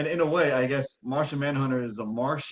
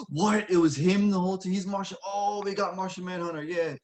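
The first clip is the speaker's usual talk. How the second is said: gasping, higher-pitched audience astonishment; mock amazed; 5 semitones higher than usual. Imagined fan reacting to Martian Manhunter in the hallway, mock amazed. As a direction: act out a delighted reveal reaction.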